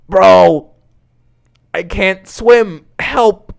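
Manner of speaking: loud, high drowning yell; panicked cry for help. Acted. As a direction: shout desperately to be rescued. Character drowning, calls for help.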